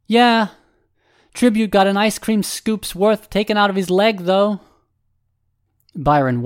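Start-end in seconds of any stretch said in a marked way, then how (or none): 0.1-4.6 high, raised character voice; team-mate calling back. Acted. Team-mate answering Byron about an injury, high and raised. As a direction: call back loudly in a much higher voice.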